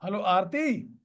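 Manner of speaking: "Hello Aarti" rising on Aarti; questioning tone. Shown as a demonstration. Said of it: "questioning accent".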